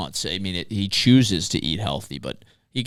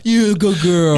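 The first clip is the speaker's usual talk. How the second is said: dog voice; 8 semitones higher, 8 dB louder than usual. Pretend. Dog voice bit continues.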